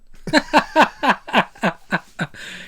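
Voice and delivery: loud burst of laughter; big laugh. Real, not acted. Laughing at own Batman bit.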